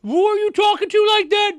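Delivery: very high, loud put-on outrage; mock indignation. Reactor voices offended blonde officer squaring up, hammed-up indignation. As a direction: mimic an affronted officer, exaggerated and shrieky. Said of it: "she walked up like".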